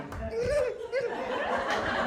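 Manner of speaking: high, airy little giggle; a smug fairy titter. Retold or imitated. Fairy wife watching him brush, high giggle. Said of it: "I just saw her over there going".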